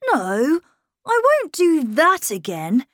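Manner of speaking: put-on a boy insisting he's grown. A narrator's reading. Miles telling his governess he's growing up.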